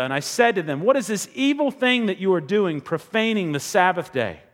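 reading the verses out high and loud like a preacher; rising preacher's read, loud and urgent; fervent scripture reading. Acted. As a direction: proclaim it with full pulpit force.

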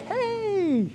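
very high bright "Hey!"; a sudden cheerful hello; cheery greeting. Retold or imitated. Adam greeting God like a friend. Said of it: "Adam's like".

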